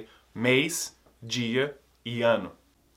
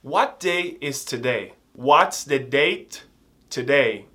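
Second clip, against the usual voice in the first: slow model questions; slower than usual. Shown as a demonstration. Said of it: "preste atenção".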